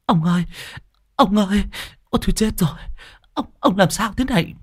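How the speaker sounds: high, tearful wailing; sobbing plea to the neighbours; wailing wife. Acted. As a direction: cry over her husband's body. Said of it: "rưng rưng nước mắt".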